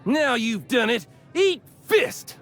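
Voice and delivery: spoiling for a punch-up; angry threat. Acted. Salvager challenging a rival to a bar fight, angry.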